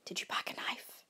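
very soft, hushed question; whispered. A narrator's reading. Camper asking friend for a weapon, whispered. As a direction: keep it down to a whisper. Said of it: "I whispered at his tent".

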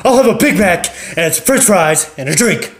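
Loud Lemmy ordering. Acted. Loud husband ordering at the counter, brash. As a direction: bark the order like a loud guy. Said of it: "Loud Lemmy comes up and he's".

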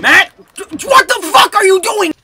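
high, very loud, incredulous yell; furious shout. Real, not acted. Player scolding teammate Matt mid-match, furious shouting. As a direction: yell at your teammate in disbelief.

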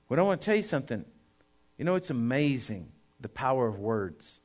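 usual speaking voice

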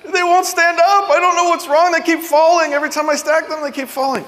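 put-on very high, loud wailing kid; hammed-up tearful child's whine; crying child. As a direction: sob it out like an upset little boy. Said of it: "And they'll say".

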